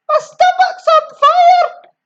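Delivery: high, loud panicked shout. A narrator's reading. Grandma burning inside, high loud shout. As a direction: yell for help in a panic. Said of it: "she shouted suddenly".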